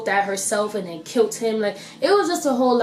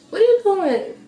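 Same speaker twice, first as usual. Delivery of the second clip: much higher, louder playful demand; mock scolding; 11 semitones higher, 5 dB louder than usual. Vlogger to viewers who haven't subscribed, mock scolding. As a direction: pitch it way up in mock disbelief.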